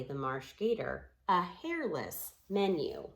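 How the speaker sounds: usual speaking voice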